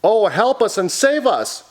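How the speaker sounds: high, swooping storybook plea; hammed-up high cry for help; pleading for rescue. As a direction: plead in mock alarm.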